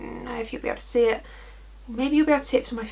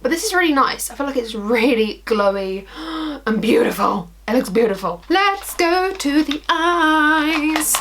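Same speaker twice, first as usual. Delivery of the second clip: usual speaking voice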